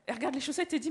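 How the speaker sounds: ordinary delivery, nothing marked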